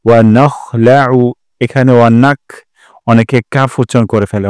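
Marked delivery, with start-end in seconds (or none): 0.0-1.3 "নাখলাউ" with a throaty খ, not কাফ; slow, scrape the kh from the throat; guttural kh sound. Shown as a demonstration. Teacher correcting a common কাফ mistake. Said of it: "খ উচ্চারণ করতে হবে".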